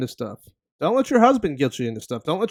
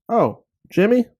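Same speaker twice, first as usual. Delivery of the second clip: high, loud fake surprise; mock surprise; 6 semitones higher, 5 dB louder than usual. Reading a text from Jimmy, mock surprise. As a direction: act startled at the name. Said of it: "and you go".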